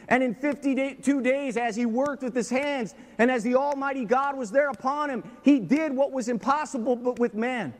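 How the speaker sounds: high, loud impassioned preaching; fiery sermon climax; preaching at full force. Acted. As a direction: drive it home from the pulpit. Preaching Nehemiah story at full force.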